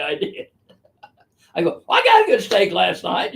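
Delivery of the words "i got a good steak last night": breezy, offhand quip, a bit raised and louder; flippant. Retold or imitated. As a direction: toss it off like you don't care. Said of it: "just being flippant".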